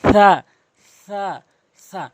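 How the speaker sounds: সা said as a lisped tha; tongue tip at the teeth for সা, not a hissed s. Shown as a demonstration. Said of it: "জিহ্বার আগা এবং সামনে দুটি দাঁতের আগা".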